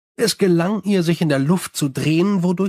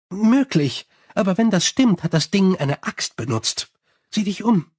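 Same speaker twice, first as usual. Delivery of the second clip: higher, chatty vampire voice; Sally complaining about her bats, higher and chatty; 4 semitones higher than usual. Acted. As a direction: rattle on cheerfully like a gossiping vampire.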